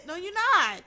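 snapping back at the guy's excuses; sharp retort. Real, not acted. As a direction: cut him off, high and loud.

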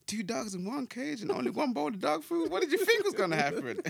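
high-pitched, bad celebrity impression; high put-on rapper voice. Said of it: "Was that your [name] voice?".

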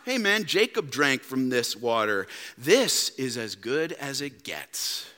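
a lightly voiced tempter, easy and slow; world's smooth pitch. The world tempting listeners, low and lulling. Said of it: "this world says".

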